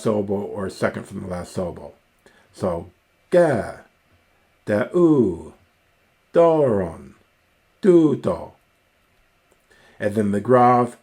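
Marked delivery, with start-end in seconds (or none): 3.3-8.5 high swoop up and down on "ga", "da'u", "doron", "tuto"; circumflex rise-and-fall. Shown as a demonstration. Lecturer demonstrating Greek circumflex, pitch rising then falling on "ga", "da'u", "doron", "tuto". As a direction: arc the voice like a horse jumping a fence on "ga", "da'u", "doron", "tuto". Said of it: "marks a raising and fall of the voice".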